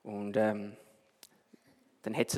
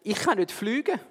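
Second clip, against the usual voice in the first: bubbling fish; 7 semitones higher than usual. Acted. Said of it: "hat blubbert".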